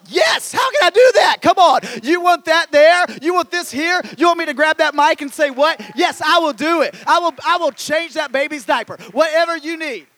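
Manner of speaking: loud, high, eager shout; eager volunteer answering the Lord's call; passionate eager yes. Pretend. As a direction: shout it like you can't wait to serve. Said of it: "you passionately".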